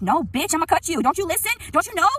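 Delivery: high, loud screamed threat; angry yelling. Real, not acted. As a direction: yell it, pitch climbing on each question. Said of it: "screaming and yelling".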